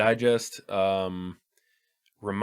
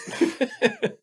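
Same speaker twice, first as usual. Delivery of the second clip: high squealing laugh; 4 dB louder than usual. Real, not acted. Laughing at crude joke.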